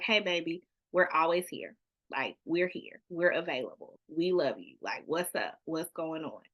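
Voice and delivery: put-on mother quietly assuring her child she's available; reassuring parent. Parent reassuring child, door is open.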